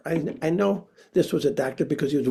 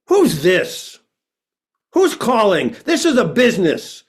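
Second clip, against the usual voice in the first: suspicious bark; 6 semitones higher, 7 dB louder than usual. Retold or imitated. Suspicious voice on phone.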